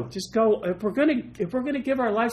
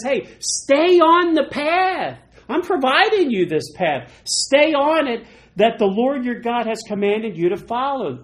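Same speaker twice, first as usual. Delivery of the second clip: God urging; 5 semitones higher, 5 dB louder than usual. Retold or imitated. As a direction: plead firmly with the flock to stay on the path. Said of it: "No, he says".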